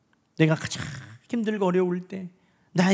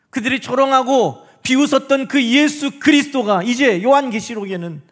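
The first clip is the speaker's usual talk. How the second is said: raised, forceful sermon climax; high, loud pulpit proclamation; impassioned preaching; 5 semitones higher, 5 dB louder than usual. Real, not acted. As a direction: proclaim it to the whole congregation at full force. Preaches the resurrection at full force.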